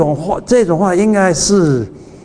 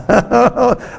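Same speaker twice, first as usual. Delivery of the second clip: short high laugh, real; a real burst of laughter; 8 semitones higher than usual. Real, not acted. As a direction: laugh at your own joke. Preacher laughs at own line.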